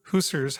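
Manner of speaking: "hussars" as HOO-serz, drawn out slowly; alternative pronunciation. Shown as a demonstration. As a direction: say "hussars" with a long OO and weak ending. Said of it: "however you wanna say it".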